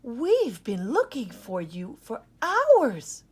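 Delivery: loud, slow relieved scolding; mother finding her lost pup; worried cry. Acted. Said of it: "cried Mom".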